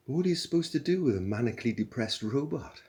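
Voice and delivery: lightly scoffing, half laughing at it; incredulous question. A narrator's reading. Zaphod mocking the robot idea, lightly voiced scoff.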